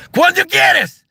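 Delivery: very high, loud rapid-fire Cuban server; Cuban server imitation. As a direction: fire it out as a shrieking caricature. Imitates Cuban restaurant server barking order.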